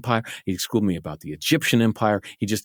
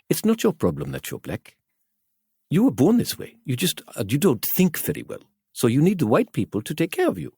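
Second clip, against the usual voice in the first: high, hammed-up South African accent; a bad South African accent, pitched up; 7 semitones higher than usual. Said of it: "I'm trying to do a South African accent".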